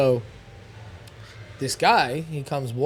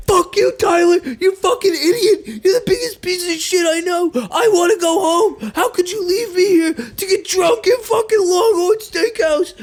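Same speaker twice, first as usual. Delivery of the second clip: very high, loud ranting shout; imitated furious yelling; 6 dB louder than usual. Guy screaming at his drunk clone friend, furious yell. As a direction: berate him like he's dragging a drunk out by the neck. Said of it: "He's like".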